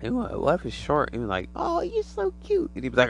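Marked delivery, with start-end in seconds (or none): 1.6-2.7 put-on very high, slow baby-talk coo. Person cooing at a tiny alien, high baby talk. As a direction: coo it like talking to a puppy.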